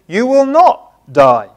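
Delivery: slow, high, loud sly serpent; serpent's lie. Retold or imitated. Preacher voicing the serpent tempting Eve. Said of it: "The serpent says".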